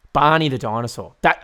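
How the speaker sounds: normal talking voice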